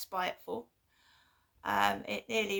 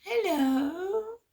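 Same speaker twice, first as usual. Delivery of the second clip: higher, sing-song mock-sweet hello; 9 semitones higher, 4 dB louder than usual. Imitating mum answering the phone, sugary and much higher. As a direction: coo it like a falsely nice mum. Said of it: "like, all nice".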